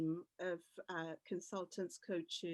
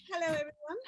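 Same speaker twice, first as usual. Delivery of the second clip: ordinary talk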